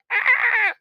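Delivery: short high shriek, hammed up; mock scream. Mimicking Jane Fonda's face, a hammed-up shriek. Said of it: "Jane Fonda's face is like".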